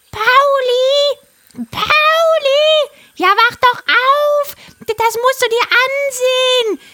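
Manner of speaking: high, loud squawking parrot voice; a frantic parrot calling out; aufgeregter Papagei. A narrator's reading. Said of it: "rief der Papagei ganz aufgeregt". (aufgeregte, schrille Papageienstimme)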